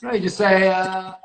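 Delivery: ordinary delivery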